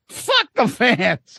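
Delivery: lightly put-on slow, drawling quote; mock quote, stretched out; Ace. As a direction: drag out the dismissal. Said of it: "And he said".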